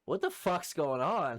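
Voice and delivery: high, loud, drawn-out disbelief; retold cry of confusion; bewildered exclamation. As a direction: act out baffled alarm, slowly. Retold reaction to being attacked.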